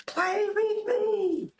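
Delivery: very high, slow, drawn-out ghostly plea; hammed-up ghost child begging to play; creepy sing-song. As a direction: stretch it out like a haunted doll begging. Spooky voice, exaggerated repeat.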